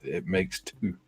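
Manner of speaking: slow patronising explanation. Pretend.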